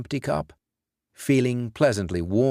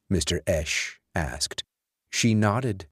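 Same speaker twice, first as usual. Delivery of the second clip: usual speaking voice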